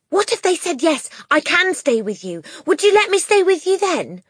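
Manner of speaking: put-on high, loud, hopeful child; eager cry. A narrator's reading. Matilda seizes hope of staying, eager high cry. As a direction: jump on the idea eagerly. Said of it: "Matilda cried eagerly".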